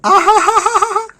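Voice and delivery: sudden loud high laugh; burst of laughter. Real, not acted. After wishing harm, bursts out.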